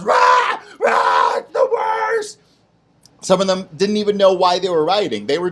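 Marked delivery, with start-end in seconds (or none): 0.0-2.4 high shrieking crowd yell, stretched out; mock rioters screaming. Storyteller screams as the rioting crowd, high and loud. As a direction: scream it like an angry mob. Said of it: "Hundreds of people are just screaming".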